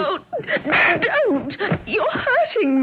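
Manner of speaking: high, hurt pleading; pained protest. Acted. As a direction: cry out as you're being hurt. Husband is binding her forcibly.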